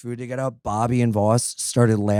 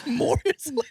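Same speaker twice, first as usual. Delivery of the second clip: laughing repeat; 13 semitones higher than usual. Retold or imitated. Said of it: "I mean, less is more".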